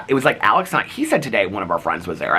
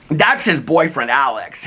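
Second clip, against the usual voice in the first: slow, higher, loud grandma impression; real loud grandma; 6 semitones higher, 3 dB louder, slower than usual. Grandma pointing out the boyfriend, talking real loud. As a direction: announce it slowly as a hard-of-hearing grandma. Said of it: "she talks real loud".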